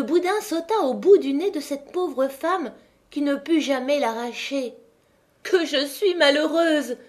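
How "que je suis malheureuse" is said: crying out in dismay. Acted. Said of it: "s'écria-t-elle".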